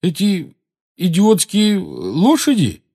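hesitant, high-pitched question; slow, incredulous uncertainty. A narrator's reading. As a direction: ask doubtfully, in halting pieces. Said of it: "неуверенно спросил Рон".

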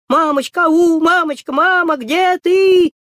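high, loud, drawn-out scared call; frightened calling. Acted. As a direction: cry out for a lost mother. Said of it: "стали кричать".

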